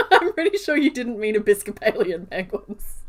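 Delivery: real laughter bursting into loud words; laughing. Real, not acted. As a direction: crack up while teasing your honey. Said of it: "I'm sorry for laughing".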